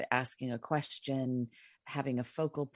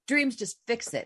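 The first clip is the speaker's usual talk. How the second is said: mildly irked order, pitched up; pissed-off command; 7 semitones higher, 4 dB louder than usual. Retold or imitated. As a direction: imitate an exasperated demand. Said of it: "I was pissed at Dana".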